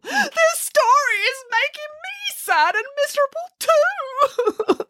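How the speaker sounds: high, slow wailing sob; sobbing Boris. A narrator's reading. Boris sobs that the story makes him miserable. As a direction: blubber it out miserably. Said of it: "sobbed Boris".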